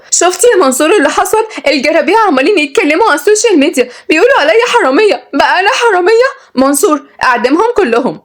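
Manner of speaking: high-pitched indignant heiress; angry. A narrator's reading. Heiress to lawyer about online insults, furious. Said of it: "واتكلمت بغضب".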